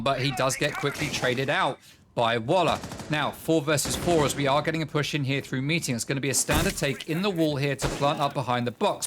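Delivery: energetic esports caster calling the action; brisk match commentary; live play-by-play. Acted. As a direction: call the round as it unfolds. Caster calls the round.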